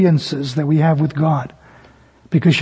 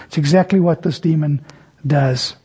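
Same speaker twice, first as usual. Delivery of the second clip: ordinary delivery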